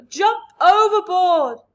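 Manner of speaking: slow, very loud command; panicked shout. A narrator's reading. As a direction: bellow to abandon ship. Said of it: "wailed the old green grasshopper".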